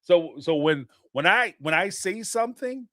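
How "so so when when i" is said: usual speaking voice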